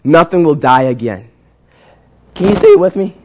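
usual speaking voice

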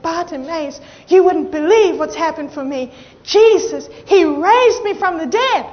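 loud, acted-out amazement; imagined girl's excited announcement; excited testimony. Healed daughter telling amazing news.

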